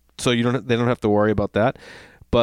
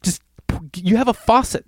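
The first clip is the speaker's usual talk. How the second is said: exasperated; 6 semitones higher than usual. Retold or imitated. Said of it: "I'm like".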